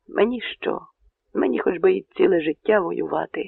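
ordinary delivery, nothing marked

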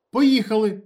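loud, high command; short shouted command; curt order. A narrator's reading. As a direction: order them to move. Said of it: "коротко наказав Ніколаєч".

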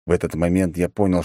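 usual speaking voice

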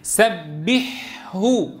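very slow "سبحه", long airy ḥ, then full hu; clear unmerged ha. Shown as a demonstration. Teacher leading class repetition, letters drawn apart. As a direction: hold the ح of "سبحه" before a separate ه. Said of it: "معك الهاء فصيحة".

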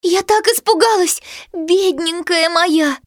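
high, slow tender voice; tender relief. Acted. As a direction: hold the puppy close and soothe. Girl hugs the rescued puppy.

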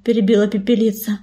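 ordinary delivery, nothing marked

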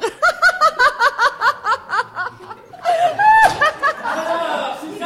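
long, high, rising peal of laughter; triumphant laugh. Acted. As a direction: let the victory ring out. Triumphant exit over the landlord.

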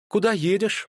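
abruptly businesslike question; brisk businesslike question. A narrator's reading. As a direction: snap into business mode. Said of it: "совершенно иным голосом и тоном".

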